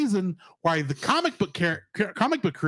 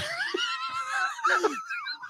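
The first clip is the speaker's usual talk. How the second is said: shrieking laugh; 5 dB quieter than usual. Real, not acted. Wild laugh.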